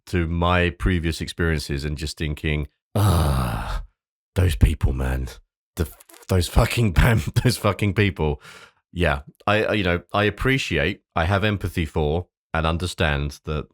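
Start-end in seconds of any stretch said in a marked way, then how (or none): 2.9-8.4 groan, then fed-up venting; exasperated reaction. Real, not acted. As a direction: vent real exasperation at those people. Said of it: "I can't help but have this emotional reaction".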